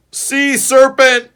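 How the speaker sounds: high, loud slow scream. A narrator's reading. The beagle screaming at the trunk in the water. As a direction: yell it in terror. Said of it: "screams the beagle".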